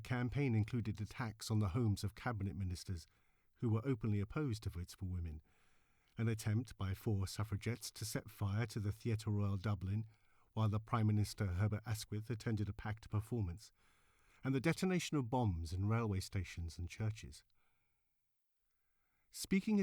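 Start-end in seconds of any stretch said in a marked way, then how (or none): none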